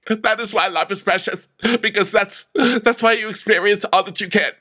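high, wailing mock dread; Vicky. Warning that everyone will die, high melodramatic wail. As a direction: lament it in a high, overwrought voice. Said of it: "And Vicky says".

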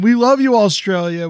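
loud affectionate call. Real, not acted. Cohost calling out love to Australian fans, loud. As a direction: shout it across to the fans.

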